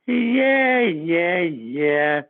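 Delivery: a sly, unconvinced skipper; knowing mock yeah. Manager brushing off the player's excuse, imitated.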